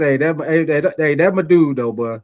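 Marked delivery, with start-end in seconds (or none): none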